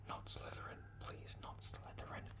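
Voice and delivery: urgent whispered plea; a frightened boy pleading in his head. Acted. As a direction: beg silently, barely a breath. Harry pleading in thought.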